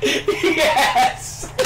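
high, laughing imitated holler; mock strained yell. Storyteller imitating Jake's answer from the bathroom, high mock yell. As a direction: yell it back as if through a door.

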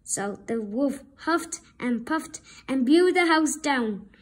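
ordinary delivery

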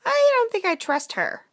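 high, loud little chipmunk voice; distrustful chipmunk. Acted. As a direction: squeak out your doubts as a small sidekick. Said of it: "Jacques told the princess".

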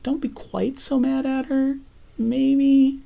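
lightly acted, high-pitched appeasing; high placating voice. Recapper voicing a character softening the news, high placating voice. Voicing Lori pleading Andrea's case gently.

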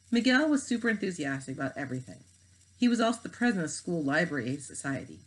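plain reading voice

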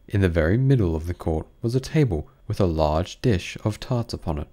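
ordinary delivery, nothing marked

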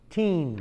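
put-on "Teen" with a firm final n; clear teen. Shown as a demonstration. Teacher showing the class "Teen". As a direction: land the n in "Teen". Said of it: "T I N".

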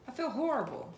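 high, loud voiced regret; remorseful confession. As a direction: wail it as the guilty partner. Reader voices remorseful masculine.

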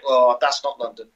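ordinary delivery

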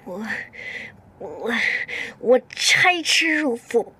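slow, halting false starts; faltering vow. Acted. Employee answering what she'd do to him, haltingly. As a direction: stumble on the first word, then stretch out the threat. Asked what she will do next.

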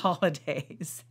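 usual speaking voice

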